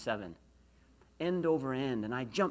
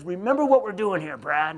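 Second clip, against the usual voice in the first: loud, raised gruff bark; booming mock-coach reprimand; 5 semitones higher, 13 dB louder than usual. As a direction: imitate a gruff coach barking a reminder. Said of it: "with a gruff voice".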